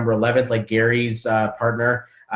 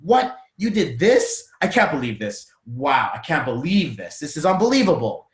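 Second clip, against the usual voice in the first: appalled disbelief. Shown as a demonstration. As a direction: scold with the words of praise, stretching "believe". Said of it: "It's the same word".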